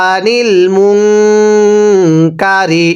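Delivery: আনিল মুনকারি drawn very slowly with the nun held nasal; nasal gunnah. Shown as a demonstration. Teacher shows gunnah to students, slow nasal recitation. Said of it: "এই নুন সাকিনটা আমরা গুন্নাহ করব।".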